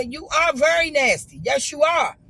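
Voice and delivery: loud, high insistence; heated accusation. Retold or imitated. Telling the bartender she is very nasty, loud and heated. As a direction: hammer the point home loudly.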